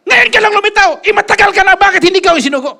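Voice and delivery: high, loud challenge; preacher mocking his critics' late arrival; taunting retort. Real, not acted. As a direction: press them hard.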